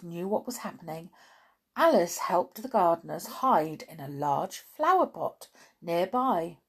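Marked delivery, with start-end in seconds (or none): none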